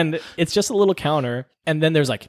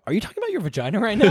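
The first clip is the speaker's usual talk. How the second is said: incredulous; 7 semitones higher, much faster than usual. Retold or imitated. Disbelieving question to patient.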